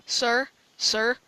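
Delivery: ordinary talk, nothing marked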